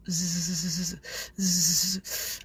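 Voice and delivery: low mouth-made buzz in pulses; imitated buzzing. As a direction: imitate a humming motor with your voice. Imitated buzz during pretend call. (bourdonnement imité avec la bouche)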